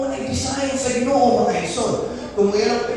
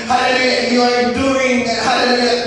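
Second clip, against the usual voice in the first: ordinary delivery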